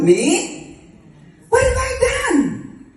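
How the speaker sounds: high, incredulous cry; shocked disbelief. Acted. Mary answering the angel's news of God's favor, shocked disbelief. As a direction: squeal it in sheer bewilderment.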